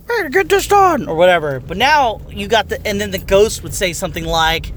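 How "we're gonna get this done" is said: much higher, squeaky goofy cheer; comic relief voice. Retold or imitated. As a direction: ham up the annoying sidekick. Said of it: "it's a comic relief voice".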